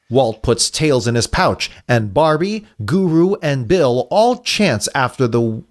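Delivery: ordinary talk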